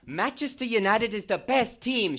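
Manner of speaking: ordinary talk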